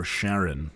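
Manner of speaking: "Charon" said as SHARR-ən, like Sharon; SH, first-syllable stress. Shown as a demonstration. Offering listeners a pronunciation choice, "Charon" as SHARR-ən. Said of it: "however you choose to pronounce it".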